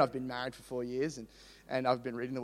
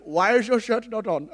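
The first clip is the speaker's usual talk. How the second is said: put-on high, loud Indian-accented question; 5 semitones higher, 4 dB louder than usual. Lease manager questioning shirtless boy, imitated Indian accent. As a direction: imitate a baffled Indian man. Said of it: "so I can do his accent".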